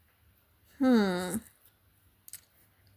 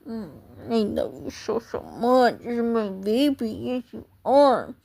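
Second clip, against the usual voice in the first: cooing to cat. Real, not acted. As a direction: murmur lovingly to the cat. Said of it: "I'm just loving on my cats".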